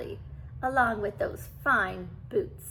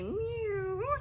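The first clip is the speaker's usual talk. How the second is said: high rising meow; purring meow; 10 semitones higher, 3 dB quieter than usual. A narrator's reading. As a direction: purr a meow at the king. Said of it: "he purred".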